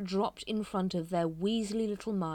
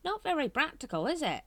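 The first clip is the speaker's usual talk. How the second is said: a silly squeaky greeting; squeaky mock king; 13 semitones higher, 7 dB louder than usual. A narrator's reading. Watchtower mocking the prophesied king, squeaky. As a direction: squeak it high and loud.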